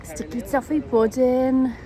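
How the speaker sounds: slow, stretched, savoured; drawn out. Real, not acted. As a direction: linger on every word. Repeats slowly, stretched.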